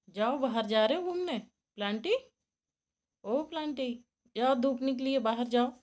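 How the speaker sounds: loud, high call across the yard; calling out to pet. Real, not acted. Owner telling her rabbit to go out in the sun, loud high call. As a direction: urge the rabbit outside, loudly.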